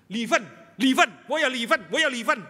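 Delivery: loud, high whiny complaint; nagging spouse. Retold or imitated. Couple fighting before the pastor, loud whiny quarrel. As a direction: mimic a quarreling spouse shouting.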